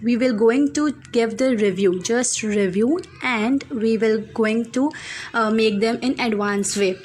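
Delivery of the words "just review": usual speaking voice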